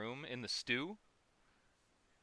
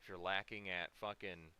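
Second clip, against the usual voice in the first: normal talking voice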